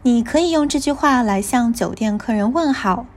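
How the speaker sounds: usual speaking voice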